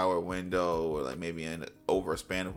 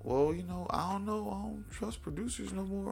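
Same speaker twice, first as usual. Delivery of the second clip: high, soft hesitant voice; put-on timid high voice; mimicked wary artist; 10 semitones higher, 6 dB quieter than usual. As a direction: imitate a reluctant artist, small and pitched up.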